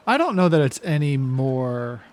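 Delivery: normal talking voice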